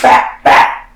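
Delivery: two loud, sharp "bah" hits; imitated punch sounds. Storyteller cuts off the argument with punches, loud imitated blows. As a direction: punch noises, short and explosive.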